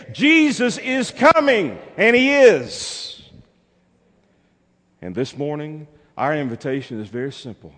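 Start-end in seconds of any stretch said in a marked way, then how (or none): 0.1-3.2 high, slow, full-force proclamation; triumphant pulpit cry; climactic preacher's shout. Real, not acted. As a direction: shout it out with long-held words. Shouted climax of repeated refrain.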